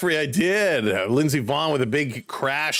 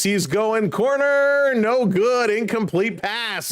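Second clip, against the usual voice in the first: rising with the play, then deflating; excited play call; 6 semitones higher, slower than usual. Real, not acted. Streamer calling the corner pass live, excited then let down.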